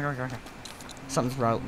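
ordinary talk

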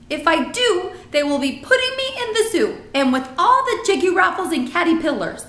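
alarmed giant, high and loud; BFG. A narrator's reading. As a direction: warn emphatically as a friendly giant.

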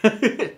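short high giggle; amused laugh. Real, not acted. Host just wrecked the audio, high giggle. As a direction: burst of giggling at your own stunt. Laughs at his own noise.

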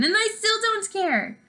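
insist, still upset; cried out. Acted. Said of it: "she cried".

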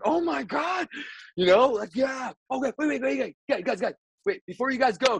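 urgent excitement. Retold or imitated. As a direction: catch them before they leave, voice way up. Stopping the band from leaving.

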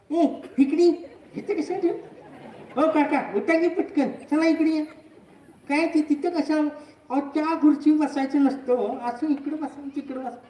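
high-pitched, fussy staffer calling patients over; bossy staff member. Retold or imitated. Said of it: "ते स्टाफला सारखं".

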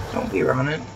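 usual speaking voice

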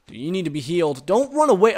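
usual speaking voice

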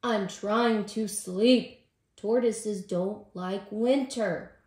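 loud, slow, exasperated complaint; angry. A narrator's reading. Tortoise snapping at Squirrel about lost sleep, angry. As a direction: drag out each word crossly. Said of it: "Tortoise said angrily".

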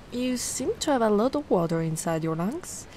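ordinary talk

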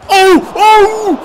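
high loud excited yelps; excited cry. Real, not acted. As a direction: yelp with sudden excitement. Big chance in the game.

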